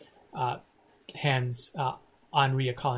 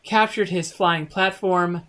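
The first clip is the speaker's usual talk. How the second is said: triumphant announcement; 5 semitones higher, 8 dB louder than usual. Real, not acted. GM congratulates players at adventure end.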